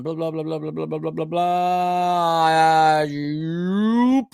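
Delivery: low monotone blah-blah swelling into a drawn-out rising finish; playful babble. Pretend. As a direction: babble idly while scanning notes. Mumbling filler while searching his notes.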